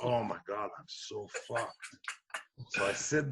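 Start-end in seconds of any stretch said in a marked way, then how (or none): 0.0-1.8 soft, sinking self-pity; quiet oh-no to yourself; retold despair. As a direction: say it under your breath, sunk.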